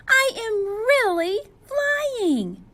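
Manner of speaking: very high, loud joyful squeal; excited. Acted. Penguin floating up on a balloon, squealing with joy. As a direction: burst with delight at finally flying. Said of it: "said Flip Flop excitedly".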